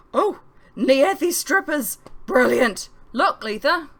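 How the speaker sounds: loud, high delighted cry; thrilled loud exclamation; excited. A narrator's reading. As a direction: gush over the new act, slow at first. Jimmy excited at stage.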